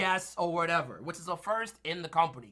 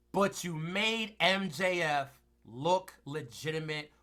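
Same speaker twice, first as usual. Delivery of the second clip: usual speaking voice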